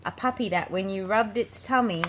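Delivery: plain reading voice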